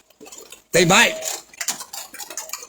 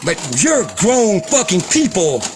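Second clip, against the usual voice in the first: normal talking voice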